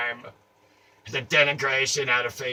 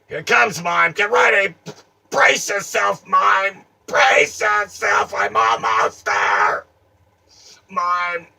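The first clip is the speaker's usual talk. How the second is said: excited charge; 8 semitones higher, 10 dB louder, slower than usual. Acted. Charging at the mime.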